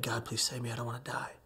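soft, subdued plea to God; retold prayer. Man in the water praying to survive, quietly. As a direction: pray it quietly, almost to yourself. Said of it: "And I prayed".

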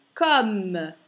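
"comme" loud, plain O then a full M; no nasal sound. Shown as a demonstration. Teacher explains double M, loud non-nasal vowel. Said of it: "qui non c'è nasale perché c'è una doppia".

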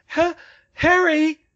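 high, loud, stammered call; worried call. A narrator's reading. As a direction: call out slowly, anxious for an answer. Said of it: "she called worriedly".

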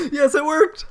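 high, gleeful exclamation; elated laughing. Real, not acted. Player whose move worked, elated laughing. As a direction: burst out laughing in triumph.

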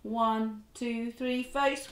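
ordinary delivery, nothing marked

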